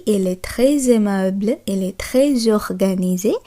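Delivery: slow "très aimable" and "très organisée", each with a z sound linking "très" to the vowel; French liaison demo. Shown as a demonstration. Teacher repeating example sentences to show the z liaison, slow and clear. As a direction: link "très" into the next vowel with a clear z, slowly. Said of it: "তার জন্যই আমি বলছি".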